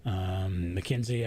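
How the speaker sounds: normal talking voice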